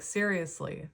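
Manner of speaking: ordinary talk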